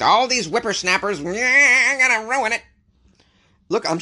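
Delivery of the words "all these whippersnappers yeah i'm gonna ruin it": high, hammed-up old-man rant; mock a crotchety old man, louder and much higher; cranky old man. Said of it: "maybe I sound like the old man".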